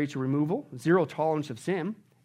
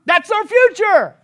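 a high, loud, slow proclamation; triumphant shout; 13 semitones higher, 14 dB louder, slower than usual. Real, not acted. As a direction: stretch it out like a victory cry. Climax about the world to come.

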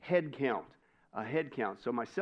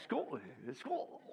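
put-on Scottish accent; 3 semitones lower, 9 dB quieter than usual. Pastor teasing Tom's welcome to Scotland, mock Scottish accent. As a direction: imitate a throaty Scottish "Scotland". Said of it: "in our best Scottish accent".

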